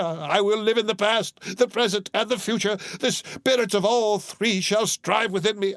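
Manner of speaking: put-on high, earnest old man's vow; Scrooge pleading. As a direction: promise it with all your heart.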